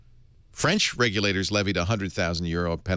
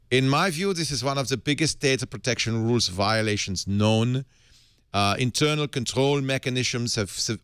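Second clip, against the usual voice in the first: hammed-up German official reading a statement; playful German accent, lightly done; mock German accent; 2 semitones higher than usual. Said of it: "They probably didn't say that in a German accent".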